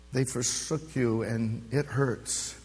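ordinary talk

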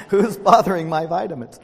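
loud, laughing indignant question; playful fake anger, laughing; laughing mock outrage. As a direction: ham up the indignation through a laugh. Mock outrage at missing chocolates.

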